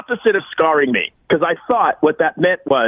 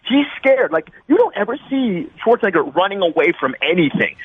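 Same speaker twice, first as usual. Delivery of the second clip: ordinary talk, nothing marked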